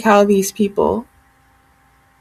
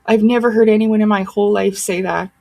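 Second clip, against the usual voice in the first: ordinary delivery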